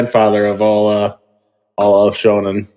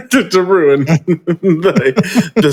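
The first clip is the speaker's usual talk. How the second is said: slow, high, broken up by laughter; laughing mid-sentence; 8 semitones higher, much slower than usual. Real, not acted. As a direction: stumble over the words through real laughter. Cracks up during moment of grief.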